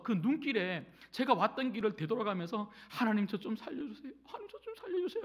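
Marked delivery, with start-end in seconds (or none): 2.9-5.2 high, fast tearful plea to God; crying prayer. Retold or imitated. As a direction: beg God through tears. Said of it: "하고 막 울면서 기도하고". (울먹이며 다급하게 기도)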